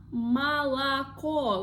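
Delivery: молоко drawn out, both first vowels as "ah"; молоко slowly as ma-la-KO, clear final O; slow model pronunciation. Shown as a demonstration. Teacher showing learners the stressed letter, молоко slowly as ma-la-KO. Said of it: "We pronounce like O, only the last".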